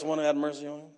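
low, reluctant mumble; grudging reply. Shown as a demonstration. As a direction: say it as if the words won't come out of your mouth. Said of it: "now I think he said it like this".

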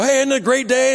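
mock sunny greeting to a room of worried people; mock cheery greeting. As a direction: play the oblivious cheerful newcomer, hammed up. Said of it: "walk into the room and go".

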